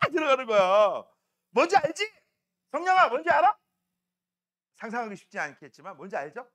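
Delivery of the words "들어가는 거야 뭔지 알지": ordinary delivery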